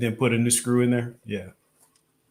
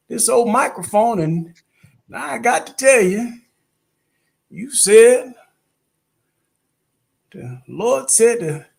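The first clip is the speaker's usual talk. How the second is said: put-on little old Southern lady; 7 semitones higher, 3 dB louder than usual. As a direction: testify like an old Southern churchwoman. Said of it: "we had the little old lady".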